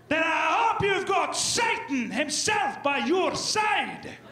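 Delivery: high, slow, booming Scottish nobleman; Scottish accent. Retold or imitated. Scottish nobleman warning the opponent, in a booming Scottish brogue. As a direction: lay on a grand Scottish brogue, drawn out. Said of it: "walk away with that weird accent".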